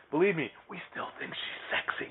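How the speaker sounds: whispered, half-joking confession; whispered aside. Acted. Co-host whispers a joke about Wonder Woman, mock confiding. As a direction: lean in and whisper it.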